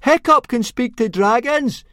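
loud high shout, slow and deliberate; shouted very loudly. A narrator's reading. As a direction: announce it to the whole crowd. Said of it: "shouted Fishlegs very loudly indeed".

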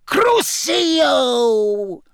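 high, loud, drawn-out curse; cold voice. Acted. Voldemort cursing Wormtail, cold high shout. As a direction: cast the curse in a piercing villain voice. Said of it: "said the cold voice".

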